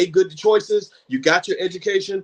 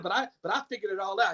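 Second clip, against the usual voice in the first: quick, hushed, higher-pitched imitation; fast, uneasy reassurance; 4 semitones higher, 7 dB quieter, faster than usual. Stressed man assuring the speaker he figured it out, fast and hushed. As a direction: imitate someone hurriedly insisting he's okay. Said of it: "He was talking kinda fast".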